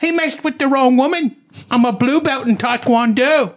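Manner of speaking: high-pitched hammed-up Cockney; mock Cockney. Garden owner boasting of her martial arts, mock Cockney. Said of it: "Just think like Cockney or something".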